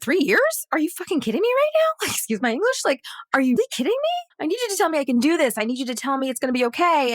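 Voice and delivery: high, rushed mock indignation; acted-out frantic protest; incredulous outburst. Retells inner outburst at agents' remark.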